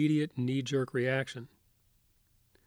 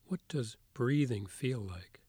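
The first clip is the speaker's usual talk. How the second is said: soft spa whisper; 4 dB quieter than usual. Acted. Leads a guided meditation.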